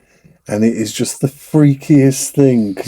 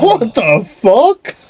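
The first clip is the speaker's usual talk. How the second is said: mock surprise; 11 semitones higher, much slower than usual. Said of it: "I'm like".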